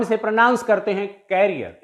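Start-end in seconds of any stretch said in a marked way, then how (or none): 1.3-1.8 "कैरियर" said as KAIR-ee-er; "कैरियर" like carrier, front-stressed; common mispronunciation. Shown as a demonstration. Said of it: "इसका गलत pronunciation करते हैं".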